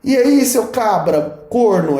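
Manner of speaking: loud-starting, slow mock regional hail; regional greeting. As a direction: call out a teasing Lavras-style greeting, drawn out. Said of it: "ensinando regionalismo também".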